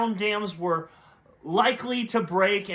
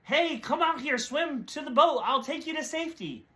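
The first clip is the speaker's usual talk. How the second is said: higher, eager call from the rowboat; rescuer calling out; 8 semitones higher than usual. Acted. Rowboat rescuer calls the stranded man, higher eager call. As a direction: call him over to the boat, pitched up. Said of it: "And he said".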